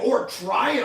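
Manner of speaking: loud, high, grandiose pitch; hammed-up manic persuasion; psycho schemer. Commander selling a deadly mission, psychotically eager. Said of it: "He's like a fucking psycho".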